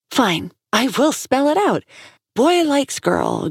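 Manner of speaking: sarcastic lecturing woman. Acted. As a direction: spell it out like to a child. Woman spelling it out angrily.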